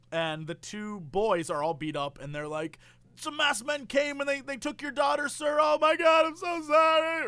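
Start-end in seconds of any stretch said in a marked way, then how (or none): 3.2-7.3 mock panic. Beaten-up boys tell the father, high mock-panicked apology.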